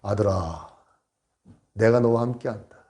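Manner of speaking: low, soft, solemn divine voice; voice of God. Retold or imitated. God answering the stranded pastor, low solemn voice. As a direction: speak as God from above, unhurried. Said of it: "음성이 딱 들려온 거예요".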